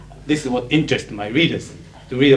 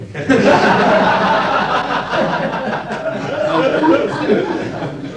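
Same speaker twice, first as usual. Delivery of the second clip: loud high laugh at his own joke; hearty laugh; 14 semitones higher, 6 dB louder than usual. Real, not acted. Speaker laughing at his own guess joke, hearty laugh. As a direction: burst out laughing, high and breathy.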